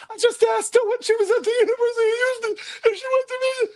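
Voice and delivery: tiny, very high squeaky falsetto; mock panicked squeal at the top of the voice; squeaking out a frightened explanation; voice ten octaves up. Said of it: "my voice went up about ten octaves".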